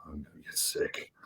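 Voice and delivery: whispered "I'm gonna get sick", barely any voice; nauseous whisper. Retold or imitated. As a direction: breathe it out like you're about to throw up. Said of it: "And I'm like".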